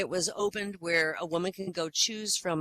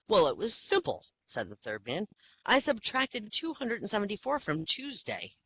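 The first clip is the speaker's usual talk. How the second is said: proud patient voice; 6 semitones higher than usual. Acted. Proud patient explains answer.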